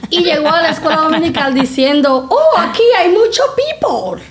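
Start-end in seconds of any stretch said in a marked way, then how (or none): 2.3-4.2 put-on high-pitched kid proudly showing off Spanglish; Spanglish-speaking little boy. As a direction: imitate a child's excited Spanglish, much higher. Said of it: "Él le cogió con hablar de que Spanglish".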